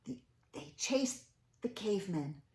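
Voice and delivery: usual speaking voice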